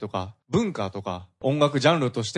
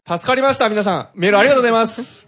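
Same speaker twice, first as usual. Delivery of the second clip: high, loud radio sign-off; rising to a loud, quick final thank-you; big on-air thanks; 9 semitones higher, 9 dB louder than usual. Real, not acted. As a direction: call out thanks to all the listeners. Host rounds off mail, thanks listeners.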